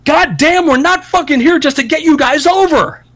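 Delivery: high, sustained mock yell; exasperated outburst. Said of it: "never yells and screams or raises his voice".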